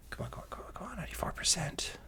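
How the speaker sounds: very soft, mostly unvoiced muttering; hushed, impatient plea to a progress bar; urging under the breath. Real, not acted. Urging a loading bar along.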